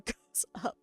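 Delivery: ordinary talk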